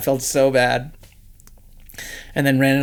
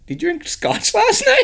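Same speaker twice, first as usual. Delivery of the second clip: laughing quote. Retold or imitated. Kels quoted after kiss, laughing.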